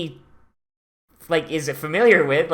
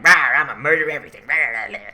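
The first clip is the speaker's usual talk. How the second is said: dumb beast voice; 4 semitones lower, faster than usual. Retold or imitated. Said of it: "They are just like".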